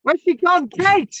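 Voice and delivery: loud high calls, looking for someone; calling out loud. Real, not acted. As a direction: shout her name into the call. Calling out for a caller who vanished.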